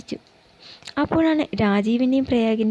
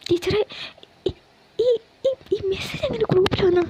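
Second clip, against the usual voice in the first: put-on high, stammering frightened young woman; പേടിച്ച പ്രിയ; 9 semitones higher than usual. Frightened teacher voice on phone. (പേടിച്ച് വിക്കി വിക്കി ചോദിക്കുന്ന ഉയർന്ന ശബ്ദം)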